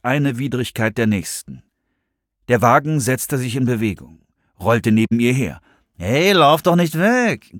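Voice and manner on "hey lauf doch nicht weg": slow, raised, wheedling coo; drawn-out coaxing come-on. A narrator's reading. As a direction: coo it after her like a pushy flirt. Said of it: "gurrte der Typ weiter".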